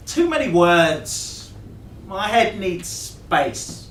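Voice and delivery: slow, loud, high drawl; loud dismissal. A narrator's reading. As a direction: stretch each word out loudly. She loudly rejects the record.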